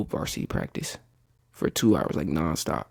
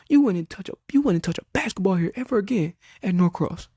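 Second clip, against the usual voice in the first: high, loud, threatening lecture; ranting coach; 11 semitones higher, 4 dB louder than usual. Retold or imitated. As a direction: lay down the law like an enraged coach. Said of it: "really was, like, spazzing on me".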